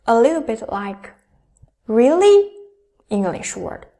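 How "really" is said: "Really" sweeping up loudly into a question; loud, high rising "Really". Shown as a demonstration. Teacher likens second tone to English, rising questioning "Really". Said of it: "from the lower pitch to the higher one".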